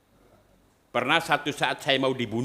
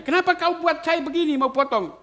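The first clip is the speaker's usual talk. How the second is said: much higher, louder demanding why; retold indignant question; 6 dB louder than usual. Priest confronting the villager who raised a machete, indignant and high. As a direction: challenge the man who wanted to kill him. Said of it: "saya tanya".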